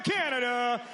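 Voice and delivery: normal talking voice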